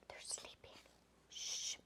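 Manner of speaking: hushed whisper over sleeping dogs; soft whisper, then shh. Acted. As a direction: whisper so they don't wake. Hushing so the sleeping dogs stay asleep.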